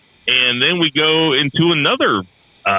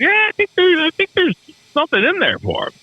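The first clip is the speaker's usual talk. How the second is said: mock hopeful self-quote. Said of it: "and I was like".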